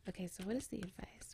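soft, quick hushed voice; whispering. Real, not acted. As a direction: keep it down so she can rest. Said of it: "have to whisper a little bit".

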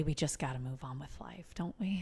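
soft unvoiced whisper. Real, not acted. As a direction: whisper it like a secret aside. Whispered aside.

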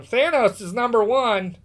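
mocked ten-year-old fan. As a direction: lightly imitate an insistent little fan. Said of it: "ten-year-old, you know, panty waifs walking around going".

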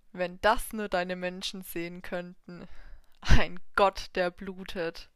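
sneering devil voice; mock the bleeding god.

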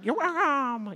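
high squawking bird voice; mock gull boasting as it flies off; cheeky seagull. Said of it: "those little seagulls had just".

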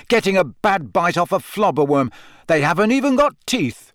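mocking outrage rising high; contemptuous, building to a high jeer; scornful. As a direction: scoff at the claim angrily. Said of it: "Harry spat".

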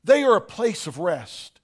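normal talking voice

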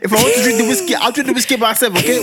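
hammed-up, cocky grown-woman sass; husband mimicking angry wife; sassy mock wife. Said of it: "she gets fresh".

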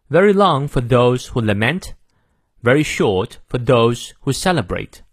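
slow, deliberate British English model reading; measured British accent with long "ah" in fast; British pronunciation. Shown as a demonstration. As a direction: read it in careful British accent, dropping R's. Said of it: "英式发音我也给你念两遍".

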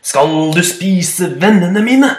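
slow loud demanding boom; roaring goat. Acted. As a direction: growl the threat slowly. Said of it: "brølte den store bukken". (langsom truende bukk)

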